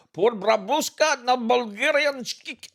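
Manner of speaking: slow, high, put-on Bulgarian-style rebel cry; mock Bulgarian. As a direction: chant it like a fake Bulgarian rebel. Fake Bulgarian rebel slogan.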